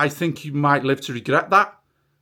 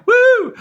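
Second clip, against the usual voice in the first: whoop; 9 dB louder than usual. Real, not acted. Mock cheer at Farage's plan.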